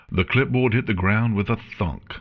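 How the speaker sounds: ordinary delivery, nothing marked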